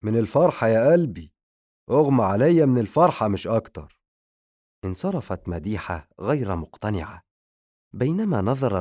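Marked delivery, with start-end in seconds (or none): 0.0-4.0 vampire's excuse. Acted. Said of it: "رقصت الفرحة في عيني الفامباير".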